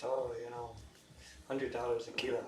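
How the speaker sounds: low, soft Russian supplier; Russian accent. Acted. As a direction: play a Russian dealer naming a price. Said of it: "Is that your Russian accent?".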